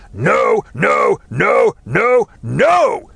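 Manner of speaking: a rising tantrum of refusals; groaning shout. Acted. Man slamming his head side to side, shouting in anguish. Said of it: "he groaned".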